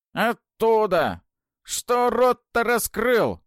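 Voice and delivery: очень высокий громкий каркающий голос; каркни, как старая ведьма; каркающая старуха. Acted. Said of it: "прокаркала". (каркающий голос старухи)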